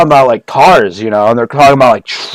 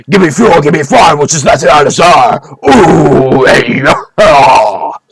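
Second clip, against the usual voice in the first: [name] impression. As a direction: belt it like a metal frontman. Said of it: "I'll do an impression of [name] real quick".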